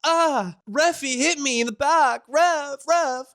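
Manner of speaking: high whiny flop to the ref; whiny Shai Gilgeous-Alexander. Retold or imitated. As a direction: whine high to the referee. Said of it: "watch him go".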